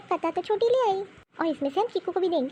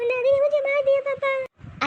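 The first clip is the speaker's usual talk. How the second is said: put-on crying child; 10 semitones higher, slower than usual. Child complains she was hit.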